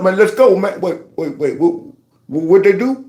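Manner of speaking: ordinary delivery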